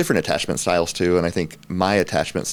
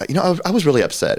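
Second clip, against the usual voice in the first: higher and quicker, a little sheepish; husband confessing to his wife, higher and quicker; sheepish confession; 11 semitones higher, 3 dB louder, faster than usual. Retold or imitated. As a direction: blurt the late admission quickly. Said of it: "And I would be like".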